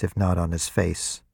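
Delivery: ordinary delivery, nothing marked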